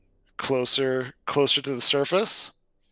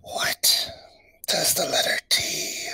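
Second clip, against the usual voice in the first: whispered, bubbling riddle voice; underwater gurgling voice; 3 semitones higher than usual. Acted. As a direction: gurgle the words through bubbles. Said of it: "I do bubbles".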